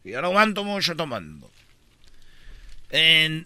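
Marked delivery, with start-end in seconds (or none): 0.0-1.5 low Castilian drinker; Spanish accent. Acted. Spaniard admits he can't hold drink, Spanish accent. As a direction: caricature a man from Spain, low. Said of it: "en España se dice".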